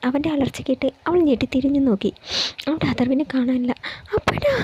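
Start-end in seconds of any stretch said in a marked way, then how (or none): 4.2-4.5 very high-pitched call without real volume; narrated scream, high but contained; acted cry out. A narrator's reading. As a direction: call out for him in alarm, pitched way up. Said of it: "അവൾ അലറി വിളിച്ചുകൊണ്ട്".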